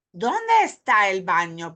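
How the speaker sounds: ordinary delivery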